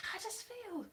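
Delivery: high, soft attempt at northern; attempted northern accent. Vlogger trying out the actress's hometown, high mock northern accent. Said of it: "I could do a northern accent".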